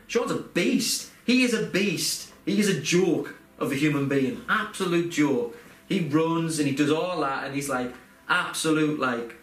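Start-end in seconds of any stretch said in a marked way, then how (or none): 0.1-4.4 slow, high and proud, savoring each word; excited admiration. Real, not acted. Raving to the host about Sean the runner, slow and high. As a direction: gush about how strong he is.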